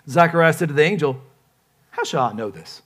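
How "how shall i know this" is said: doubting. Acted. Acts doubting Zechariah.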